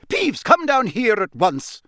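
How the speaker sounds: high, loud, scolding order; irate teacher's command; angry yell. Acted. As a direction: order him down at once, furious. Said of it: "yelled an angry voice".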